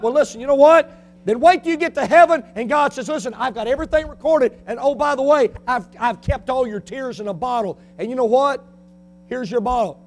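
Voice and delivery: loud, high preaching with acted quotes; fiery preaching. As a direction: hammer the point, voicing the listener and God. Preaching at full force.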